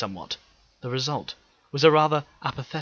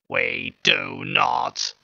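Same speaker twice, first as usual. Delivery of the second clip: louder, very slow harsh robot voice, nearer a natural register; second Dalek voice; 5 dB louder, much slower than usual. Acted. The second Dalek naming its kind, slow harsh voice. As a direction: drag the name out mechanically.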